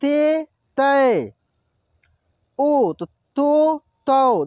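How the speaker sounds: ते, तै, तो drawn out slowly; slow syllable demo. Shown as a demonstration. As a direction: stretch each syllable for the class. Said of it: "ए". (ते, तै, तो को धीरे लंबा खींचें)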